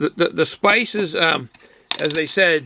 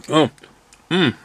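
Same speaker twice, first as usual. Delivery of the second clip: usual speaking voice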